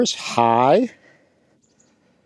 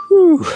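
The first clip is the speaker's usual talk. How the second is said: high, loud breathless whew; 5 dB louder than usual. Real, not acted. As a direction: blow out after running uphill. Ran back up the hill.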